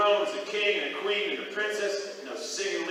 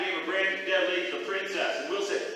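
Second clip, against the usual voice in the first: plain reading voice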